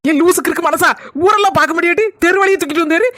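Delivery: ordinary talk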